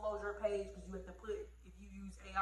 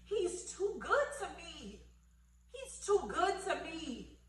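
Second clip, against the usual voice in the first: overwhelmed gratitude; 7 semitones higher, 4 dB louder, slower than usual. Retold or imitated. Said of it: "I'm just like".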